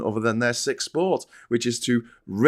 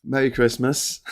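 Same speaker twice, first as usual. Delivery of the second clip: ordinary delivery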